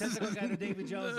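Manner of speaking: put-on high laughing pirate accent; Caribbean accent, laughing. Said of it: "Can you say it in, like, the Caribbean accent?".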